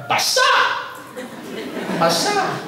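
dismayed shriek at the ruined laptop; shocked cry. Retold or imitated. Finding the laptop soaked.

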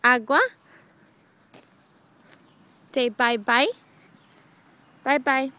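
loud, rising word prompts; speaking to a toddler. Shown as a demonstration. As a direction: coax each word out of a baby. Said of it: "Say, Mommy".

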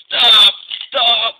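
high, very loud yelps; shouted stop. Acted. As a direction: yell for it to stop. Diesel dizzy, begs twins stop.

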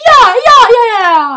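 triumphant yeah. Real, not acted. Kid cheers after the riddle, loud triumph. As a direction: cheer it out like a win.

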